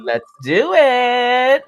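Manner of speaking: drawn-out high call; bingo caller. Acted. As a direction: call it like a game show host. Bingo number reveal.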